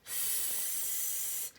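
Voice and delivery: long hiss, drawing nearer. Acted. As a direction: hiss like an approaching snake. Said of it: "It gets louder".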